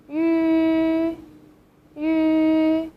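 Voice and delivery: "Yu" said as yi with rounded lips. Shown as a demonstration. Teacher modelling the vowel for learners, twice. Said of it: "start from saying yi first".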